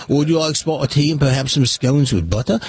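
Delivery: put-on English accent; imitation English accent. Host takes his turn for British judge, imitation English accent. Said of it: "It's your turn".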